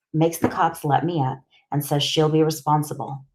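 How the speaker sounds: ordinary delivery, nothing marked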